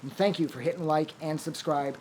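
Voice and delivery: quiet closing thanks to viewers; soft outro. Acted. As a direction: thank them gently, winding down. Soft outro thank-you read.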